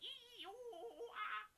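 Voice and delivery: high, slow monkey hoots with a laugh; monkey shrieks. Acted. Monkeys shouting back at the man, high hooting. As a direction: hoot like a cheeky monkey. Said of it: "They probably went".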